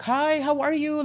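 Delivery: high, slow singsong hello; chirpy staff greeting. Retold or imitated. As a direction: imitate overly friendly nurses greeting a patient. Imitates the greeting medical staff.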